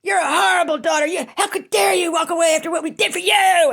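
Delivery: a high, loud mock shout of accusation; imagined mother shouting. Imagined mother shouting at her estranged daughter, high mock shout. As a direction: shriek it like the furious mother. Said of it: "this is what I'm thinking".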